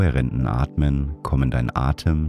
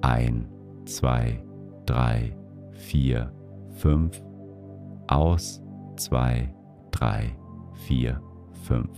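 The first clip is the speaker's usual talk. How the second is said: counting breaths; 4 dB quieter, much slower than usual. Acted. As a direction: count the breath gently to five. Counts each breath to five.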